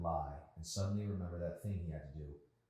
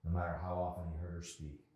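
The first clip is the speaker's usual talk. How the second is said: plain reading voice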